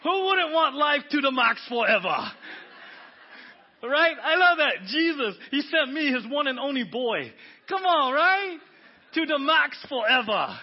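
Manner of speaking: loud, high, laughing delight. Real, not acted. To the congregation, thrilled by the Pidgin verse, loud and laughing. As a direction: burst out with joy at it.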